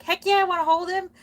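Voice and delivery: high, loud eager yes; excited exclamation. Retold or imitated. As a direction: blurt it out eagerly. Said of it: "I'm like".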